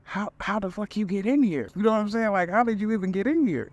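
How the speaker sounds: high-pitched, startled demand, re-enacted; retold alarmed disbelief. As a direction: act out waking to an intruder, voice jumping high. Retold shock at intruder over bed.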